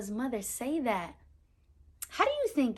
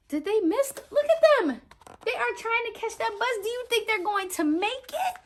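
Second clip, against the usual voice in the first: excited suspense; 10 semitones higher than usual. A narrator's reading. Tense bus chase.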